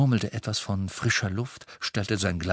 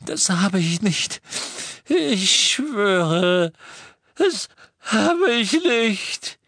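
put-on high, loud, desperate old voice; terrified denial; 11 semitones higher, 5 dB louder, much slower than usual. A narrator's reading. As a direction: swear it in panic, dragging the end. Terrified prisoner swears innocence. (hohe, panische Beteuerung)

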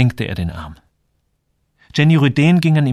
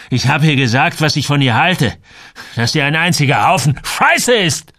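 louder, higher, heated retort; angry outburst; 4 semitones higher, 5 dB louder than usual. Acted. Samuel tells Joel what he told Jenny, angrily. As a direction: throw it out with rising force.

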